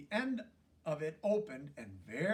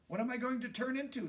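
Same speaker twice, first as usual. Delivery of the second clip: eager question; 8 semitones higher, faster than usual. Acted. Pollywog asks eagerly.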